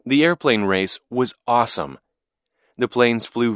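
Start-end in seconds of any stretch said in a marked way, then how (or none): none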